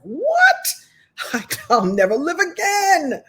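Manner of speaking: high-pitched, hammed-up heartbreak wail; mock teenage despair. Speaker recalls first heartbreak, mock teenage despair. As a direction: play it as melodramatic teenage doom.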